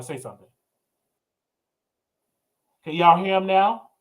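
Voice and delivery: ordinary delivery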